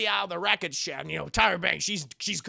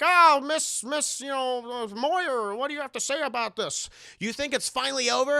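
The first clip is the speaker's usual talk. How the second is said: higher, eager reporter voices; a hint of pushy reporters; 5 semitones higher, 3 dB louder than usual. Reporters crowding Sarah, lightly voiced eager questions. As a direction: pester her like a news crowd. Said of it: "they're like".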